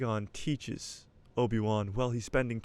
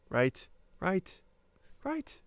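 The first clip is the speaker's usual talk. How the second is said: rising right; 8 semitones higher, much slower than usual. Real, not acted. Rising questioning asides.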